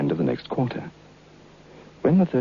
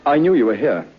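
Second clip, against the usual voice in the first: excited call; 7 semitones higher, 7 dB louder than usual. Acted. Narrator greets ghost on doorstep.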